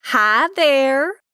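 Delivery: put-on bright, high, thick Southern accent greeting; a Texas-thick Southern hello. Neighbor greeting Lainey at the door, thick Southern accent. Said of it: "with a very thick Southern accent".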